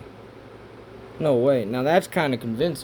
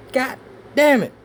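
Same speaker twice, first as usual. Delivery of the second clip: frustrated outburst; 12 semitones higher, 6 dB louder than usual. Real, not acted.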